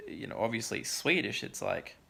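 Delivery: usual speaking voice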